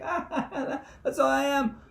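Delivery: high, loud hearty put-on laugh; Buddha laugh. Speaker voices the laughing Buddha, laughing high and loud. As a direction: cackle like a jolly Buddha. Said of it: "little fat laughing Buddhas".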